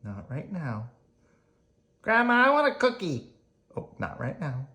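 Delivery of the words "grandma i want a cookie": put-on high child's demand; child pestering grandma for a cookie; whiny child.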